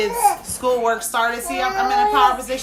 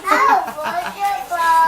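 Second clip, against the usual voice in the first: loud high burst of laughter; 10 semitones higher, 6 dB louder than usual. Real, not acted. Mom laughing at her own joke about her kids, loud burst. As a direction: laugh out hard.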